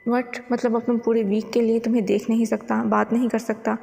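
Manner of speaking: plain reading voice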